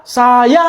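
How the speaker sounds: SAYA with the last vowel held very long; drawn-out name. Acted. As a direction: stretch "Saya" out on one long held note. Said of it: "bukan nyebutnya, dipanjangin".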